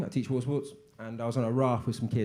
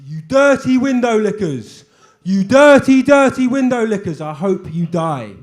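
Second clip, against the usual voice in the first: much higher, louder mock screaming; mock builders' yell; 8 semitones higher, 13 dB louder, slower than usual. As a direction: bellow it like rude builders. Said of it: "they were screaming at us".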